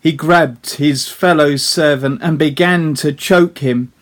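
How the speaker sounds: ordinary talk, nothing marked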